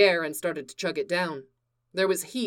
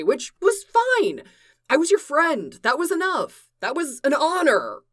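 emotional confession; 4 semitones higher, 4 dB louder than usual. Acted.

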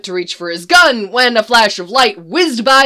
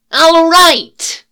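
a much higher, much louder roar; 7 semitones higher, 8 dB louder than usual. A narrator's reading. Leonard silencing two screaming groups, loud roar. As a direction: bellow it to stop the screaming. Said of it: "Leonard roared".

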